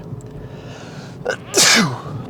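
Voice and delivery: a real stifled sneeze; sudden sneeze mid-sentence; sneezing. Real, not acted. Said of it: "Sorry, I'm gonna sneeze".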